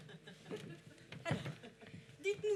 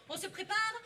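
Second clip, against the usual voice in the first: normal talking voice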